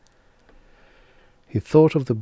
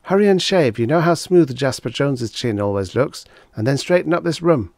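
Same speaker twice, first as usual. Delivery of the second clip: higher and louder, scolding wife; wife scolds Henry for reading before the guests arrive; Agnes nagging; 6 semitones higher, 5 dB louder than usual. Acted. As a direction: hurry him along, exasperated. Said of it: "She had emphasized the last word".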